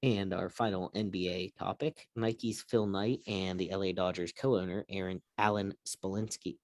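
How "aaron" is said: ordinary talk, nothing marked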